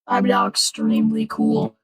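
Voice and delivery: through a voice changer. Real, not acted. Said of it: "the cool voice changer voice".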